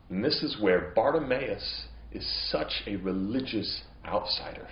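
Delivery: ordinary delivery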